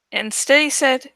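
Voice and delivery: ordinary delivery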